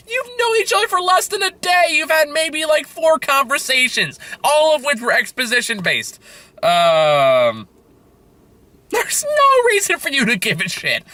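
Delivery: high-pitched incredulous ranting; exasperated high ranting at a lovesick scene; indignant rant. Real, not acted. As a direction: complain at the screen in disbelief. Ranting at the movie's romance.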